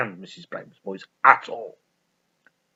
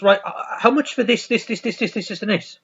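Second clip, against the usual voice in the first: high, keen bargaining voice; eager buyer; 9 semitones higher than usual. Retold or imitated. A customer eagerly pricing the photos, high and keen. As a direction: hurry to buy every photo. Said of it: "And he goes".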